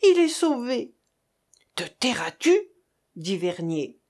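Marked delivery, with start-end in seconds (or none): 0.0-0.9 high-pitched exclamation of relief; acted elated outburst; joyful cry. A narrator's reading. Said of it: "s'écria-t-elle". 1.7-2.6 harsh whispered reprimand; low, voiceless scolding; hushing. A narrator's reading. Vernier silencing her cry.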